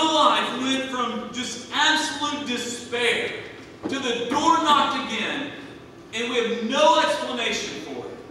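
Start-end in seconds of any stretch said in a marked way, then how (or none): none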